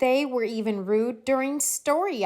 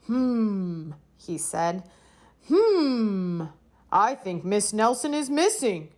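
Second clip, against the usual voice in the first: pondering detective. A narrator's reading.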